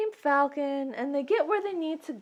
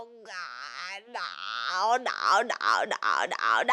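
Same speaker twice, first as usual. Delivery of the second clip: low, building to a shout; mock anguished cry; 7 semitones lower, much slower than usual. Luke watching Ben die, mock anguished cry. Luke's anguish at Ben's death.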